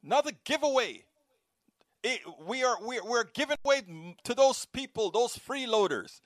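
mock right-wing outrage. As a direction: mimic outraged conservatives. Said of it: "the right wing goes berserk".

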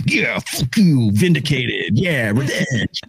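slow drawn-out mock gloat; smug gloating. The inner voice gloating over vindication, slow and smug. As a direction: savor the revenge, slowly. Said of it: "there's a part of you that go".

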